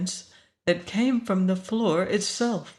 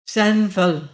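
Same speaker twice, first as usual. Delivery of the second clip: louder, proud woman's summons; commanding woman; 2 semitones higher, 6 dB louder than usual. A narrator's reading. Bodiless woman calls for Sanfel, commanding. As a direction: call out with the pride of one who rules. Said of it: "voice of a woman used to wielding power".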